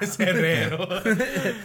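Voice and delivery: slow, laughing correction of the name. Acted. Player correcting the name, laughing and drawn out. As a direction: correct him while cracking up. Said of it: "It's Herrera".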